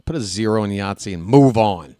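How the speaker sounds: normal talking voice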